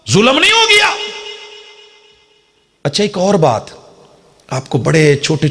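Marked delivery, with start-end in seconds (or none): 0.1-0.9 sky-high indignant rhetorical question; indignant high cry. Acted. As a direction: voice leaps way up in mock outrage. Repeats question, mock outrage.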